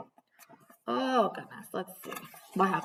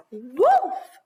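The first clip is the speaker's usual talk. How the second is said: loud, higher exclamation on "Wolf!"; exclaimed; 5 semitones higher, 6 dB louder than usual. Shown as a demonstration. Teacher showing children how to read an exclamation, loud. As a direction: shout "Wolf!" out with the exclamation point. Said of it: "we say it like".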